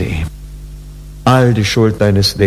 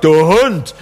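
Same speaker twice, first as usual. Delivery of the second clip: a clipped, contemptuous "Du Hund" with a falling end; insulting tone; 5 semitones higher than usual. Shown as a demonstration. Preacher shows how "Du Hund" sounds as an insult. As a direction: spit out "Du Hund" as a put-down. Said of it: "dann ist eine furchtbare Beleidigung".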